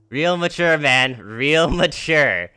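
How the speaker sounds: whiny mocking shout. A narrator's reading. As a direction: whine it out, drawn at the end. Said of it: "a whiny voice shouts".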